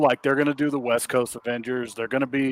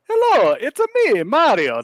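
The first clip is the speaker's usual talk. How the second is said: very high, louder cartoon Italian plumber voice; Mario impression; 13 semitones higher, 4 dB louder than usual. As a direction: ham up a squeaky Mario voice. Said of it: "They would have gone".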